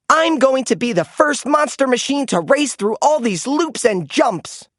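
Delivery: Darrington's excited voice. A narrator's reading. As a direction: announce the big stunt with pride. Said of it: "Darrington told Blaze".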